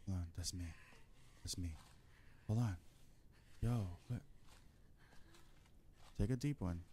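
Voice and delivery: quiet. Real, not acted. Tense moment, hushed.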